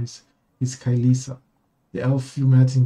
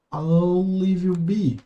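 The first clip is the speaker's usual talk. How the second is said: slow character voice; 4 semitones higher, much slower than usual. Acted. Character line, slow and set apart.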